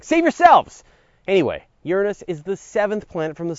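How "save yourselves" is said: high, loud hammed-up cry; mock desperate warning. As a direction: yell it like a doomed hero in a B-movie. Mock doomed warning to viewers.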